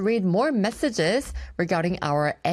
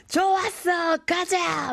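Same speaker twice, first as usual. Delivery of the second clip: rowdy cheer; 12 semitones higher, slower than usual. Retold or imitated. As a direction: shout it like a tipsy coworker rallying the table. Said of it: "막 이러면서".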